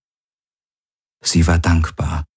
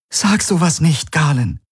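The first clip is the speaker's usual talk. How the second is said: pleading protest; 10 semitones higher than usual. Acted. She begs him not to say it.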